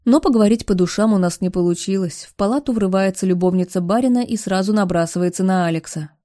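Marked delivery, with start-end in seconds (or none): none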